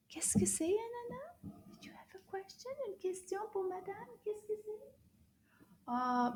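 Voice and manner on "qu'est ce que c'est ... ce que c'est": high, soft little questions; light, high coaxing voice; talking to a puppet. Pretend. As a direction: ask a small puppet softly, pitched high.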